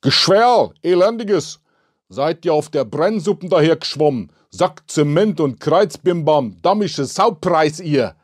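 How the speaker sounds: high, loud torrent of Bavarian cursing; furious Bavarian tirade. A narrator's reading. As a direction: rant angrily in thick Bavarian dialect. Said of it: "lautstarker, nicht enden wollender Schwall bayerischer Mundart".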